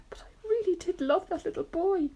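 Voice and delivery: high, tearful storybook voice; acted weepy lament; mock sobbing. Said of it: "the earthworm sobbed".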